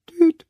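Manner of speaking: kleines, helles Hupen wie ein Spielzeugauto; tüte ganz hoch und kurz; hohes Tüten. A narrator's reading. Tiny horn sound. (hohes Hupgeräusch)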